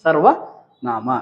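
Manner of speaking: very slow, drawn-out syllables; slow teacherly enunciation of ಸರ್ವನಾಮ; drilling a term. Shown as a demonstration. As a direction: stretch out ಸರ್ವನಾಮ for the class to catch. Teacher repeats the new term slowly.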